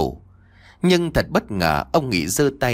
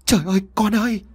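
wailing cry; 10 semitones higher, faster than usual. Acted. Mother wailing as her daughter gives in, grief-struck. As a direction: howl out in grief for her child. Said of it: "bà Cẩm gào lên".

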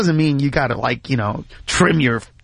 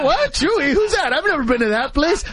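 high, startled "What?"; an incredulous yelp; shocked disbelief; 7 semitones higher than usual. Retold or imitated. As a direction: jolt up at the strange name. Reacting to the odd cheque name.

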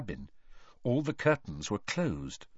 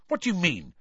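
sharply; 9 semitones higher, faster than usual. A narrator's reading. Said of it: "said Harry sharply".

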